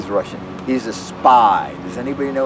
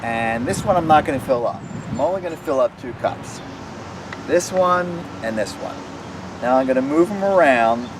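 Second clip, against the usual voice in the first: put-on children's magic show patter; 3 semitones higher than usual. Performing trick for children.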